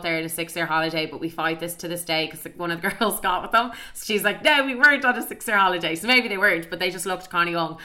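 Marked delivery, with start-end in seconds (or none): 4.4-5.9 louder, higher mock protest; indignant denial. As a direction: imitate a friend defensively objecting, pitched up. Voicing the friend's denial.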